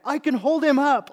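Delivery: high, loud imitation of a straining kid; mock kid showing off his strength; kid's proud boast. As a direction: shout it like a boasting little boy. Child boasting while lifting brother.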